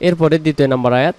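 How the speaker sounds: normal talking voice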